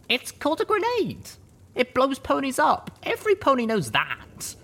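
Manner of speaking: pink filly calling out. A narrator's reading. Pink filly calling out to the companion, know-it-all. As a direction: call it out like an obvious fact. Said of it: "a pink filly called out".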